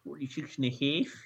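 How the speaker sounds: put-on light Chicago accent, nasal flat a. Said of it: "You're going with my Chicago accent".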